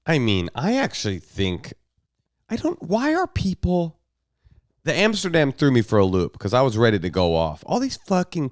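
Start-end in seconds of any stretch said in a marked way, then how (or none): none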